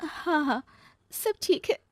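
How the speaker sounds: high, tearful false assurance; acted weepy, high voice; on the verge of tears. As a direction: insist while starting to cry. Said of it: "कहते कहते ही वो रोने लगती है".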